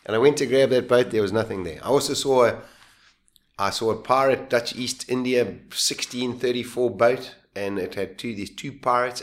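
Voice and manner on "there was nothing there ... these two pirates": ordinary delivery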